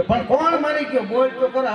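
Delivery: exasperated, pressing for an answer; mock exasperated shopkeeper; impatient demand.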